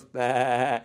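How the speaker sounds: imitated goat-like bleat; lion cub bleat. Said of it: "little lion cub goes".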